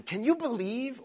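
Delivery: high, whiny "Can you believe?"; mock disbelief. Someone unleashing a petty complaint, mock incredulous. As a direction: toss it off in mock outrage. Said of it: "unleash this statement or this question".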